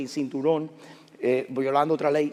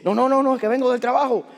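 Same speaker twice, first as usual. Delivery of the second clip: high, loud string of protests; acted-out urgent excuse; hurried protest; 8 semitones higher, 6 dB louder than usual. Stopped biker pleading his case to police, protesting.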